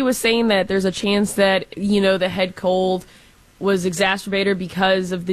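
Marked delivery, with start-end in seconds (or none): none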